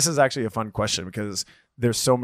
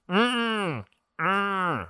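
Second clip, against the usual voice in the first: high mock whine through closed lips; imitated waking-up grumble; 9 semitones higher than usual. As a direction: whine a high "mm" like someone just woken. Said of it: "like, when you wake up".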